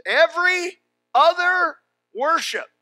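loud, high shouted preaching; preaching at full force. Acted. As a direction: thunder it from the pulpit. Preaching peak.

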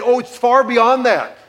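slow, high, loud, laughing; sarcastic laughing jab. Retold or imitated. Father's imagined retort to son, slow and laughing. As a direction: savor the put-down.

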